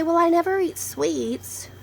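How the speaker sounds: drawn-out, self-assured protest in imitation; mock naive denial. Vlogger mimics people who deny their diabetes risk, mock naive denial. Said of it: "eat potatoes all day and say".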